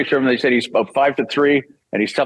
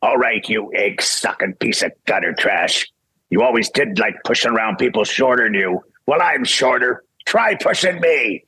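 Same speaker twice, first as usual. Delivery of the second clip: Wolverine. Shown as a demonstration. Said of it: "this is the first line I read".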